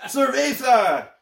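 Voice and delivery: high, slow, drawn-out Spanish "Cerveza"; mock Spanish accent. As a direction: stretch "Cerveza" out in a hammed-up accent. Repeated louder, slower.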